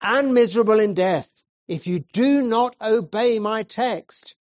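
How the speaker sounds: high and loud, slowing on the promise; preacher urging young man, loud and earnest; loud earnest preaching. Acted. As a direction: press the warning, then offer salvation. Preacher warning then promising.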